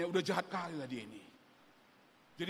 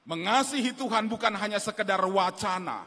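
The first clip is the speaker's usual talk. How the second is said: "Mengasihi Tuhan" said louder than before, pressed hard; forceful repeat; 4 semitones higher, 9 dB louder than usual. Shown as a demonstration. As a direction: hit the repeat harder. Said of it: "contrasted repeat".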